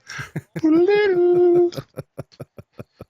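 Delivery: shrill, loud cackle far above his voice; high shrieking laugh. Real, not acted. Cracking himself up after singing the chorus, high shriek. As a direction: burst into a squealing laugh.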